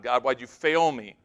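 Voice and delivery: ordinary talk, nothing marked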